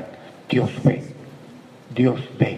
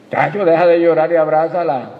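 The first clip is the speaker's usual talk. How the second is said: higher, louder teasing call; mock urging; 5 semitones higher, 7 dB louder than usual. Preacher acting out someone urging Tacho, loud and higher. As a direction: egg Tacho on with a shout. Reunion scene acted out.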